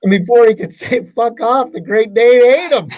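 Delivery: high, loud, building punchline. Acted. Joke punchline, delivered big.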